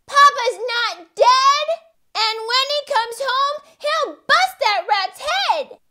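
put-on very high, loud child voice; small mouse defiant; little mouse. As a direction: insist like a plucky kid. Young mouse defies the news.

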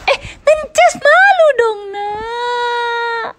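very high playful squeal. Real, not acted. Teasing baby, high.

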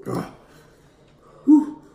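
ordinary delivery

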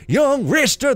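loud, rising preacher-like proclamation; booming divine lord. Acted. Builder to kneeling poor novice, booming proclamation. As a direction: build to a high, slow, ringing command.

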